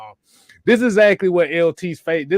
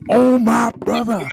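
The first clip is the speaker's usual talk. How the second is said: hyped reaction; 6 semitones higher, 6 dB louder, slower than usual. Real, not acted. Reacting to rant.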